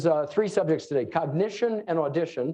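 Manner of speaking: normal talking voice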